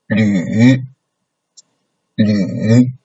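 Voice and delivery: falling-rising LÜ; third tone. Shown as a demonstration. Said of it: "like the third tone".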